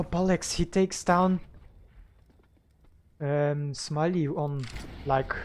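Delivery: live play-by-play commentary; esports caster. Real, not acted. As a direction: call the match round by round.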